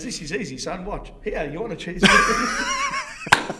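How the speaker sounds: big mate's line dissolving into laughs; laughing quote. Retold or imitated. Offering a stranger a spare cheeseburger, laughing. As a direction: crack up while offering the burger.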